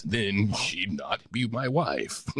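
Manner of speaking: drawn-out, swooping radio delivery; hammed-up performer voice, slowing at the end; affected announcer voice. Shown as a demonstration. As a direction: overdo the voice-over tune on every phrase. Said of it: "If I talked to my wife like this".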